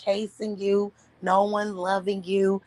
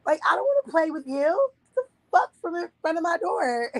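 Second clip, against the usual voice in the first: put-on whiny kid; 10 semitones higher than usual. Kid self telling boy off.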